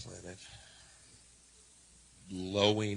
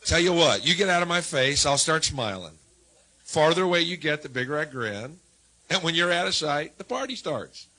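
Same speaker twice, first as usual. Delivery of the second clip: mock angry rant; 5 semitones higher, 7 dB louder than usual. Ranting at annoying strangers.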